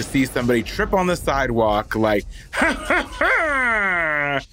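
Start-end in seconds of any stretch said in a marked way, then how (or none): 2.5-4.4 high, put-on mocking cackle; hammed-up villainous ha-ha-ha, pitched way up; petty gloating laugh. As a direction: laugh gleefully at someone's stumble, drawing out the last ha. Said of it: "Petty Curly is like".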